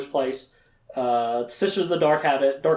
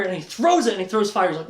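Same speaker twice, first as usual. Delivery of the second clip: usual speaking voice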